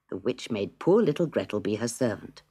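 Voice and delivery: usual speaking voice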